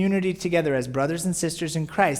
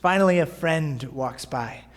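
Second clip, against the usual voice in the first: ordinary talk, nothing marked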